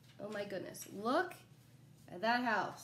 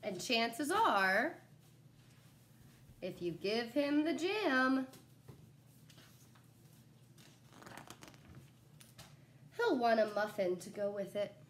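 put-on reading to children.

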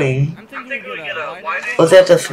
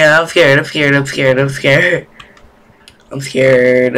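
ordinary talk, nothing marked

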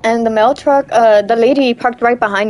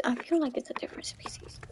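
fast, hushed whisper; 18 dB quieter, faster than usual. Real, not acted. Player spotting a new species, whispering fast. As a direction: whisper so the creature won't hear. Sneaking near a creature.

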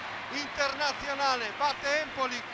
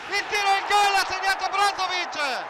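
shouted goal call, higher and louder; breathless italian goal commentary; 6 semitones higher, 5 dB louder than usual. Real, not acted. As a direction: scream the goal.

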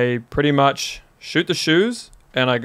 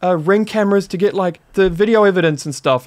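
higher-pitched, urgent imitation of alarmed people; retold tripping out; 6 semitones higher than usual. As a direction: act out their frantic plan. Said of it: "They're, like, tripping out".

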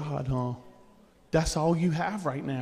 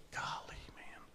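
whisper; 17 dB quieter than usual. Real, not acted. Aside after a striking point.

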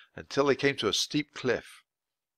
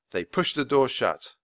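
ordinary delivery

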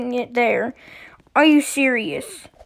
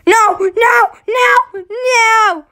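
high, loud wails of no; despairing cries; 9 semitones higher, 5 dB louder, much slower than usual. Real, not acted. Player wailing no as everyone falls. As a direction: wail as your friends die.

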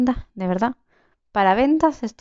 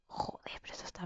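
alarmed hushed whisper; whispered dismay; 24 dB quieter, faster than usual. Real, not acted. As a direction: whisper the curse so nobody hears. Surrounded by players, hiding.

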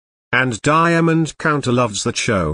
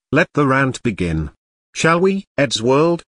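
normal talking voice

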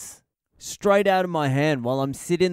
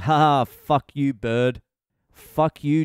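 louder triumphant jeer; gloating taunt; 3 semitones higher, 5 dB louder than usual. Retold or imitated. After snatching the sandwich back from the bird, loud gloating jeer. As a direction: crow over the bird like you won. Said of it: "I'm like".

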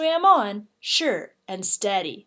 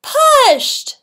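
dramatic exclamation; 11 dB louder than usual. A narrator's reading. Dramatic push into river.

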